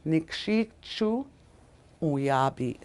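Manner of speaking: Nikshichu slow, stop before Uyabi; corrected take. Shown as a demonstration. Teacher correcting her own mispronounced Nikshichu Uyabi, slowly. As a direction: break cleanly between Nikshichu and Uyabi. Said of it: "I kinda slaughtered those words".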